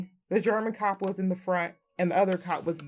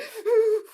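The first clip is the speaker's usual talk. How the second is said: sharp high loud gasp; loud gasp of fright; panicked gasp; 8 dB louder than usual. Retold or imitated. Acting panic in car.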